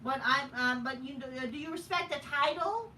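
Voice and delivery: mild sneering imitation; mocking wrestler quote. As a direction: parody her earnest speech. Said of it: "The fat whale bitch says".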